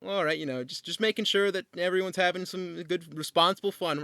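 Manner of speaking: a hint of a patrol officer's friendly warning; police officer. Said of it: "this police car kinda rolls up".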